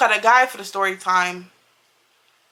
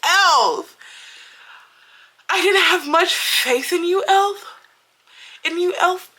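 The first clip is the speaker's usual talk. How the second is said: higher voice admitting doubt to the brand; surprised admission; 7 semitones higher, slower than usual. Real, not acted. Vlogger speaking straight to elf, surprised and higher. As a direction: own up that the product won you over.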